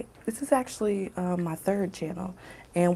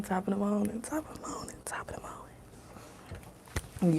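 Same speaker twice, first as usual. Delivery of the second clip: fading whisper; 7 dB quieter than usual. Pretend. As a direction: let it trail off to a whisper. Greeting fades to whisper.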